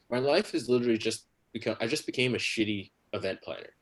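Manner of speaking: normal talking voice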